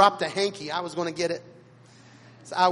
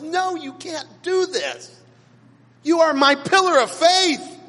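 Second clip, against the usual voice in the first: higher, louder disbelieving cry, slowed at the start; anguished plea; 9 semitones higher, 7 dB louder than usual. Retold or imitated. Young man silently pleading with his raging hero, anguished cry. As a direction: beg your hero to stop, voice climbing.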